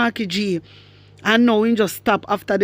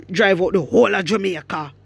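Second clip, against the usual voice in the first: normal talking voice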